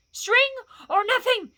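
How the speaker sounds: high, loud creature shriek; piercing high-pitched blurt; Gollum shriek. A narrator's reading. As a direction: shriek it out desperately as a cornered creature. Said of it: "shrieked Gollum".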